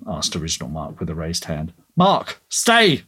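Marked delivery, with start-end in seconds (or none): none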